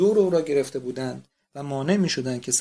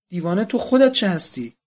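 louder, biting comeback at her sister; angry retort; 4 semitones higher, 6 dB louder than usual. A narrator's reading. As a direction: throw the insult back hard. (پاسخ تند و خشمگین)

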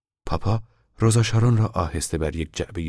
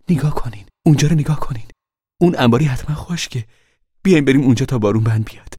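higher, louder, urgent mother; eager pointing out; 8 semitones higher, 7 dB louder, faster than usual. A narrator's reading. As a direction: point it out, fast.

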